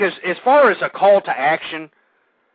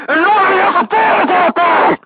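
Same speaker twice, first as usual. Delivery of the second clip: shouting; 11 semitones higher, 7 dB louder than usual. Real, not acted. Rant peaks after mock scream.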